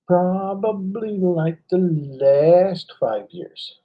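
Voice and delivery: imitated slow, elevated pronouncement; drawn-out mock answer. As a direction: stretch every word like a mentor delivering the punchline. Imitates seminar speaker's slow punchline answer.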